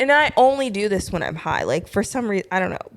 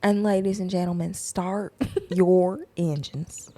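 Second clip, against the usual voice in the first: Southern belle declaration. Pretend. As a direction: draw out each slogan like a Southern race starter. Comic Southern persona's three-thing declaration.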